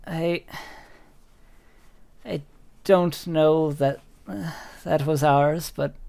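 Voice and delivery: lightly voiced old woman, halting; guarded thanks with long pauses; hesitant old lady. Old woman receives a lost duck from a student, lightly voiced and hesitant. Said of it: "kind of hard to read her expression".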